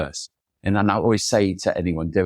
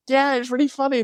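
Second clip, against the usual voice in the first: high, put-on gushing agreement; mock-enthusiastic, very high reply; fake gushing. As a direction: pretend you get the joke, overeager. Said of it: "And then I went".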